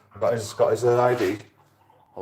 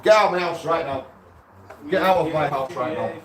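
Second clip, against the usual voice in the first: angry; 5 semitones higher than usual. Real, not acted. Tenant ordering landlord out.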